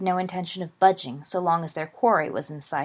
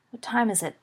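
ordinary delivery

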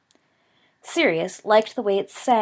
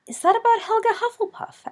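youthful voice; 4 dB louder than usual. Acted. Boy asks, voiced much higher.

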